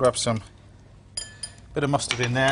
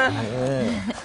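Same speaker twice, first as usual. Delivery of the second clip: laughing; 9 semitones higher, 4 dB quieter than usual. Real, not acted. Laughs at own French bit.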